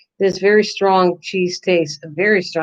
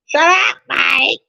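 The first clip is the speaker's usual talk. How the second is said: higher, louder mock snap at Mike; imitating pop rock; 5 semitones higher, 3 dB louder, slower than usual. Mimicking pop rock telling Mike to shut up, high playful snap. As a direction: toss off a sharp playful shut up, imitating a friend. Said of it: "the way pop rock says it to me".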